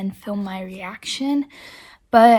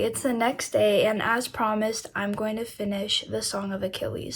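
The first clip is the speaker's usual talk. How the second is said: normal talking voice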